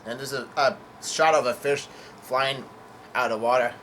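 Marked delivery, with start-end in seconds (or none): none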